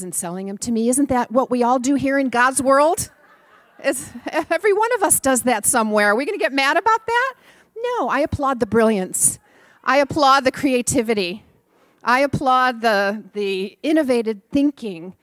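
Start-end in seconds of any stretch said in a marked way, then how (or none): 0.9-7.3 louder, laughing sermon point; rally the audience with a laugh; rousing preaching. Real, not acted. 7.8-15.1 louder, emphatic preacher cadence; preaching. Real, not acted. Sermon peroration.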